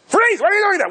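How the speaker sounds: high, sharp mock yell; mock shout. Calling out to someone who walks in, hammed-up shout. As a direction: shout freeze at an intruder.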